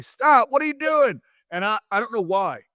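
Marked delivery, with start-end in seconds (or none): 0.0-1.2 high, loud mock yell; imitating an opponent's startled objection; alarmed protest. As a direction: cry out in protest, pitched up. Voices Owen protesting mid-match.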